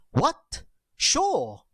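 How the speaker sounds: high, exclaimed "What? Sure."; "What" and "Sure" thrown out high as exclamations. Shown as a demonstration. Teacher recalls the loudest tone, high exclaimed "What? Sure.". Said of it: "Remember exclamation tone?".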